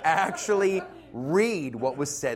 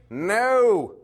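emphatic shout; 5 semitones higher, 6 dB louder than usual. Acted. Emphatic rhetorical answer.

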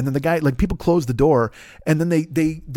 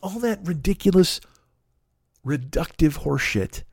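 normal talking voice

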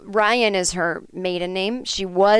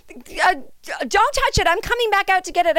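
high, frantic mock bride; the bride desperate to stop her; panicked bride; 12 semitones higher than usual. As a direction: squeal it in alarm. Bride panics over her phone.

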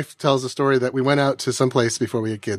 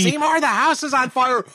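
put-on high old mother voice; 10 semitones higher than usual. Quoting Skinner's mother scene.

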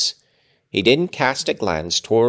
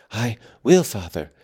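panting. A narrator's reading. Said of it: "he panted".

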